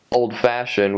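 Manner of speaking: ordinary talk, nothing marked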